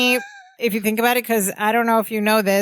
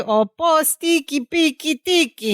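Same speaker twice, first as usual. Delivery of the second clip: mock saying; 6 semitones higher than usual. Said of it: "on Mypos we have a saying".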